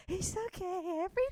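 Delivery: very high, manic mock reassurance; singsong "everything's fine" while losing it; hammed-up unhinged brightness in a squeaky pitch; retold psychotic cheer.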